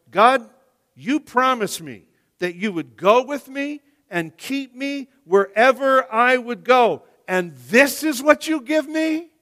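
high, loud, exasperated cry at God; hurt and accusing, pushing the voice up; indignant complaint. Acted. Preacher voicing Jacob complaining to God, high exasperated protest.

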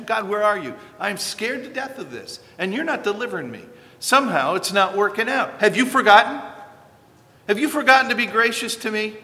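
starts pleading, rises to a loud, high shout; frightened plea building to a cry. Acted. Psalmist terrified and abandoned, pleading then shouting at God. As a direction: fear growing until it bursts out.

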